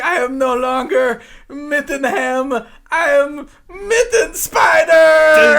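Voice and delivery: high, slow, climbing to a shouted cry; hammed-up villain reveal, ending in a loud cry; monster transformation cry. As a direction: roar out the new monster name. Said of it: "he just does this part where he's like".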